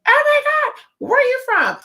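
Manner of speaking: very high gushing voice of an overjoyed shopkeeper; excited shop lady. Retold or imitated. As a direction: squeal it like a thrilled old lady. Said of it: "she was like".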